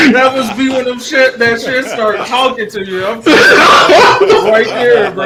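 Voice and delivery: laughing while talking; words through laughter. Real, not acted.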